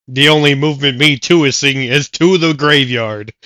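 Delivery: play-by-play announcer. Acted. Announcer calling the battle.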